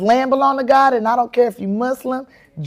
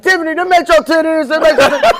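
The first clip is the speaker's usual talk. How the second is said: laughing through the taunt, a bit higher; mocking laughter; 8 semitones higher, 6 dB louder than usual. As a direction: tease her while cracking up. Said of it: "everybody be laughing like".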